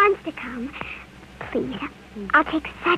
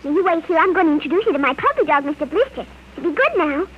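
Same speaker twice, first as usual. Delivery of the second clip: ordinary talk, nothing marked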